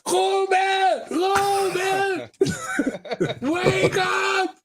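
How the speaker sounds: high mock shout through laughter; calling to wake a sleeper, half laughing; retold shouting. Promoter banging on the door, a laughing mock shout. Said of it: "they were shouting on the door".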